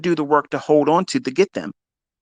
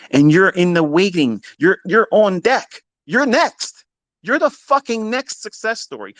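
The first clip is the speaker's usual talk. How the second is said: much higher and louder, building; rousing hype; 7 semitones higher, 3 dB louder than usual. Real, not acted. Coach tells viewers they're next, rousing and loud. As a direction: hype them up like a coach before the game. Pumping up the audience.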